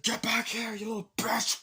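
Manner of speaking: high, hammed-up villain yell; mock angry villain. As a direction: imitate a villain chasing someone. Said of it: "the villain's like".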